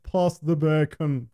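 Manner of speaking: slow mock English accent. Shown as a demonstration. Playing at English table talk, slow hammed-up English accent. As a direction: draw it out in a put-on English accent. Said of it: "say it like you're English".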